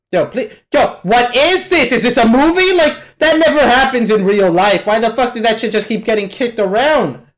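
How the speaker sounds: loud, high, incredulous rant; exasperated outburst. Real, not acted. Viewer fed up with the stone getting kicked around, exasperated outburst. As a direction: yell in frustration at the screen.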